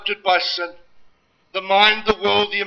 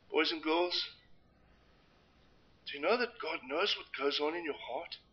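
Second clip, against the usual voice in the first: put-on hushed, gentle talk to children; quiet; 8 dB quieter than usual. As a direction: soften for the little ones.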